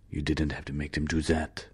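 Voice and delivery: soft, subdued rebuke; hushed disapproval; quiet reproach. Acted. Lesperance quietly reproaches Travis.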